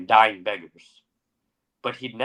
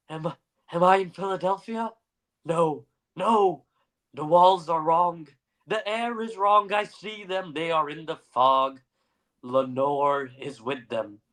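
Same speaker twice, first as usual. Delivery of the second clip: high, lost, trembling delirium; raving, unsure where he is; delirious poet voice; 6 semitones higher than usual. Acted. Confused Poe raving in fog.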